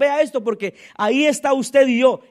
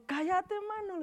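higher, soft, put-on voice; cállate; 6 semitones higher, 13 dB quieter than usual. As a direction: hush him off in a higher, hushed voice. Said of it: "le decía yo". ("cállate" dicho agudo y bajito)

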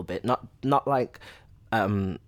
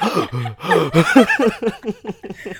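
exaggerated gasp; 12 semitones higher, 13 dB louder than usual. Gasping after dance class.

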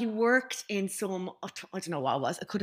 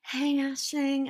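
slow, raised voice of a gym admirer; high-pitched creepy admirer; 5 semitones higher, much slower than usual. Retold or imitated. Gym member greeting her, imitated high and slow. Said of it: "in a really high pitch".

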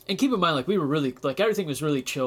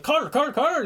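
calling out a name; 10 semitones higher than usual. Retold or imitated. Security staff hurrying the hockey star along, calling his name. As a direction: hail him like a handler steering him through.

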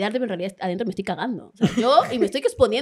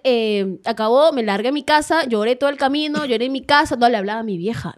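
ordinary delivery

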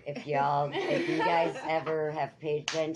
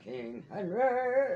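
long, drawn-out high roar; hammed-up King Henry; 8 semitones higher, 4 dB louder, much slower than usual. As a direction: play the gluttonous king with a turkey leg. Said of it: "I always joke around".

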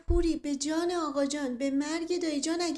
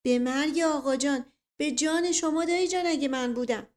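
pleading; 5 semitones higher, 6 dB louder than usual. Acted. Boy swears innocence, cornered.

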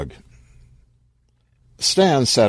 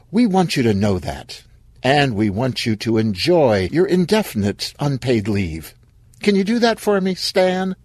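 higher-pitched corporate cheer; chirpy company-speak; bright HR person, upbeat; 6 semitones higher than usual. Acted. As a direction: deliver bad news sweetly, lifting to a high question at the end. HR person cheerfully dismisses employee.

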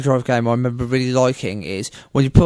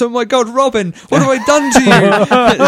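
high, loud, slow mock lament; hammed-up guilt-stricken cry to Robin; anguished Batman; 10 semitones higher, 7 dB louder than usual. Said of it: "then Batman goes".

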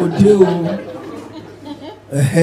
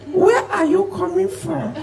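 high, soft, slow demon taunt; 10 semitones higher, 5 dB quieter than usual. Acted. Demon taunting the trekking comedian, high and soft. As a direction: tease him slowly in a thin high demon voice.

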